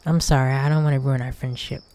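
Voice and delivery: ordinary delivery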